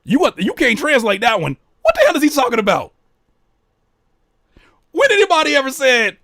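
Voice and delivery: high, loud incredulous questioning; baffled outburst. Real, not acted. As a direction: demand an explanation in loud disbelief. Baffled protest at the lyric.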